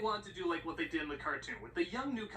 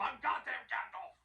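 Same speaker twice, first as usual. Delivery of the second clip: character voice; 7 semitones higher than usual. Pretend. Character voice in parody.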